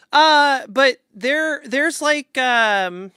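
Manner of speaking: usual speaking voice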